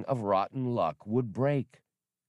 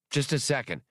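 snapping; 6 semitones higher than usual. A narrator's reading. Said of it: "Han snapped at Viola".

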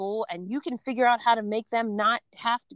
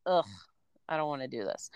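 low, soft groan of dread; retold weary grumble, low and quiet; miserable reluctance; 8 semitones lower, 6 dB quieter than usual. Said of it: "We hated it".

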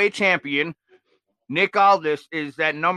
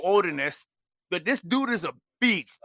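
ordinary delivery

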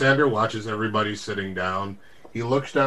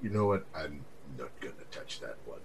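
dropped low and much softer, deadpan; dry dismissal; 9 semitones lower, 12 dB quieter, faster than usual. Acted. Lysander brushing off the server's tease, low and soft. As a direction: mutter it off, not taking the bait. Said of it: "he just kinda goes".

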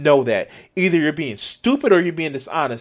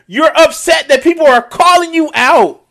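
ordinary talk

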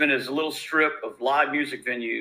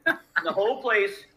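usual speaking voice

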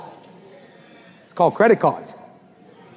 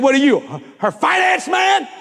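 mocking salesman taunt; 9 semitones higher than usual. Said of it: "He said".

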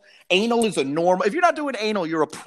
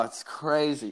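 usual speaking voice

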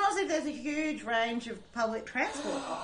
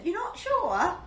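high-pitched Geordie lilt, rising into a question; Geordie accent; 7 semitones higher, slower than usual. Shown as a demonstration. Co-host teasing about the retold phone call, in a Geordie accent. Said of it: "say it in a Geordie accent".

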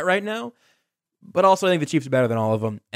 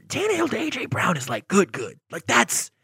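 ordinary talk

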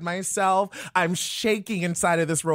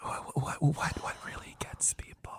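ASMR whisper; breathy ASMR whisper; 6 semitones lower, 12 dB quieter than usual. Shown as a demonstration. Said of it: "start scratching".

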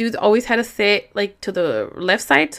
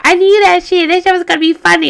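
much higher, louder, fast giddy friend voice; imitating giddy friend; 10 semitones higher, 6 dB louder, faster than usual. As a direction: do the friend squealing that she knew it would be funny. Friend voiced laughing at prank.

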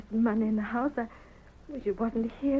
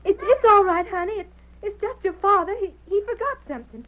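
raised, tense voice, fear held down; frightened mother; 11 semitones higher, 9 dB louder than usual. Acted. Mother calling to her daughter upstairs, frightened. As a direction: call out bravely though terrified.

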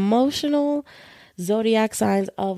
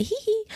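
high giggle; 4 dB quieter than usual. Real, not acted. Giggle before reading.

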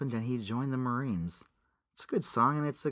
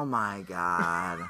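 exasperated groan; 7 semitones lower, 3 dB quieter, much slower than usual. Real, not acted. Groaning reaction, slow low.

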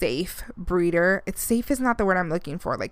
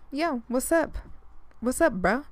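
joking bro voice; 5 semitones higher than usual. Pretend. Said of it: "I'm just kidding".

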